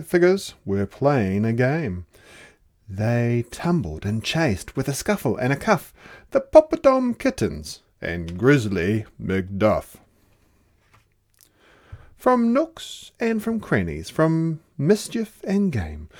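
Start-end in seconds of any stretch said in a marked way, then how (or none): none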